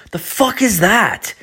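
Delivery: very high, drawn-out startled cry; startled disbelief. Retold or imitated. Man in the shower hearing a stranger mumble, high startled cry. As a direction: retell your shock, voice leaping way up.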